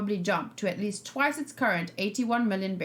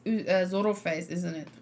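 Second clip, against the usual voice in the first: ordinary talk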